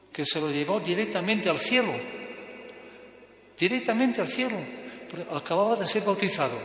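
preaching with growing fervor; fervent preaching. Acted. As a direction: drive the moral home to the faithful. Preaching the moral with force.

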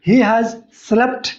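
very slow with a rise on he; slow wrong example. Shown as a demonstration. Teacher slowly models the wrong sentence students should avoid. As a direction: lay out the wrong form slowly. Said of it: "ऐसा भी नहीं बोलेंगे".

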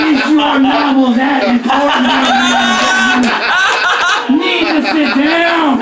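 hammed-up crusader scolding the train; justice warrior rant.

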